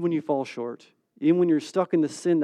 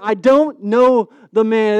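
loud, slow, raised, heated denial; vehement denial; 4 semitones higher, 8 dB louder, slower than usual. Acted. Disciple cursing and denying he knows Jesus, vehement. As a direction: swear it loudly, stretching each word. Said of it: "Peter started to curse and swear".

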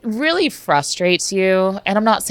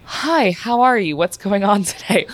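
cheery greeting; 5 semitones higher, slower than usual. Retold or imitated. Greeting patient after closing door.

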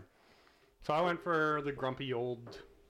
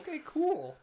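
mock casual; 13 semitones higher, 5 dB louder than usual. Reacting casually to a revived robot.

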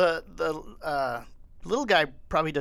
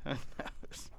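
hushed conspiratorial whisper with a laugh; 14 dB quieter than usual. Acted. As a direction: breathe it like a juicy secret. Repeats line, then whispers it.

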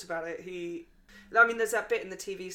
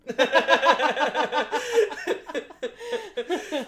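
loud laughter; 8 semitones higher, 9 dB louder than usual. Real, not acted. Laughs at sand joke.

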